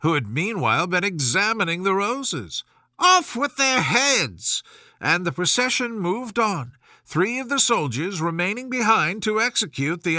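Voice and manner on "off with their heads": high, slow, commanding royal bark; imperious Queen's command. Acted. Queen condemning the gardeners, slow imperious command. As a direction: order the execution with regal fury.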